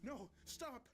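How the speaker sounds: high, desperate cry of protest; inconsolable. A narrator's reading. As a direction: fight being dragged away. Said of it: "I was inconsolable".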